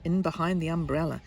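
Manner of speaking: ordinary delivery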